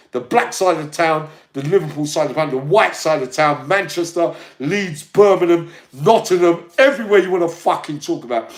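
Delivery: high, loud bragging; loud boast. Real, not acted. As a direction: roll the list out loudly. Boasting loudly about his reputation.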